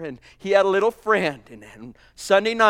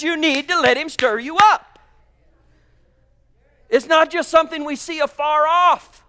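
higher and louder, driving each line home; preaching at full force; 8 semitones higher, 5 dB louder than usual. Acted. Preacher rouses the brothers and sisters to take the land, full force. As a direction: stir them up, push hard to the end.

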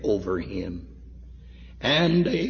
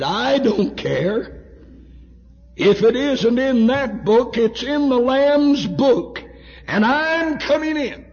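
high, loud, defiant proclamation; defiant insistence; 14 semitones higher, 7 dB louder than usual. Retold or imitated. Curry arguing with the angel at the gate, defiant insistence. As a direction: insist at full pitch on being let in.

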